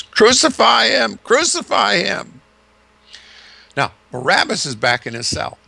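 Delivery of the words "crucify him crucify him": high, loud screaming chant; crowd screaming. Retold or imitated. Preacher voicing the coached crowd, screaming crucify. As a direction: scream it like a whipped-up mob. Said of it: "taught to scream is".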